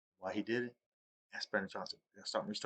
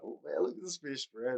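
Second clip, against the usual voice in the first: higher-pitched playful boast; bragging; 7 semitones higher than usual. Retold or imitated. Angler teasing his fishing buddy from across the pond, bragging. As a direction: show off the catch, pitch raised. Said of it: "I'm bragging".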